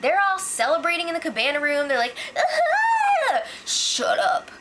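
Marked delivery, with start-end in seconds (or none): 2.4-3.4 a sudden, very high, loud whoop; celebration shout. Retold or imitated. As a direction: whoop like a winning houseguest. Austin and Liz celebrating.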